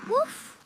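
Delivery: two high little woofs; puppy woof. Acted. Puppy answers a child calling it over, high woofs.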